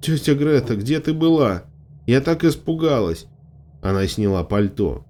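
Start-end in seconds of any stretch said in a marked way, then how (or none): 0.0-3.2 higher-pitched, anxious reproach; plaintive, worried outburst; upset and scared. A narrator's reading. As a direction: let lingering fright push the pitch up. Scared girl confronts returning aunt.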